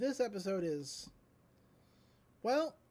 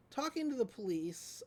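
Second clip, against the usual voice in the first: usual speaking voice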